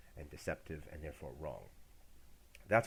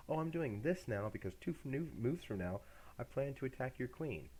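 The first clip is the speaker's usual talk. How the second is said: mock naive chess player; 7 semitones higher than usual. Mocking an oblivious chess player.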